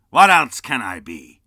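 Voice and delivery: very loud angry retort; cross uncle. Acted. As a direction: snap back. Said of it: "returned the uncle".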